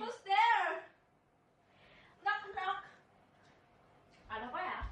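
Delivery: put-on high campy girly voice; sassy drag queen. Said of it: "kayak bencong".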